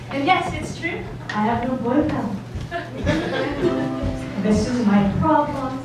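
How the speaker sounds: ordinary talk, nothing marked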